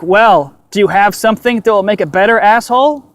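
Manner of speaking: loud, high snap at the doctor; angry retort. Retold or imitated. Patient snapping at the doctor for mocking advice, loud and angry. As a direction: spit it back at him, fed up.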